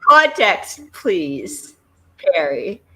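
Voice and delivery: loud, exasperated calls for context; loud demand. Real, not acted. Host yells at a character, demanding context. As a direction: shout for an explanation.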